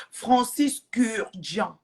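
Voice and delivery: Francis Kurdian spelled out slowly, syllable by syllable; sounding out the name. Shown as a demonstration. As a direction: streamer reading out the perfume maker's name from a viewer's message, slow and careful. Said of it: "Carole vient de m'écrire ça".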